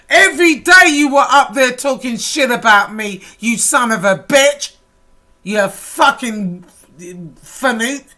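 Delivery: ordinary talk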